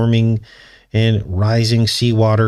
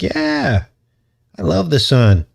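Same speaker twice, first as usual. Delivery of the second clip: happy alligator voice; 5 semitones higher, slower than usual. Pretend. Alligator voiced enjoying the sun.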